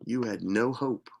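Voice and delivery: ordinary talk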